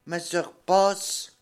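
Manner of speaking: normal talking voice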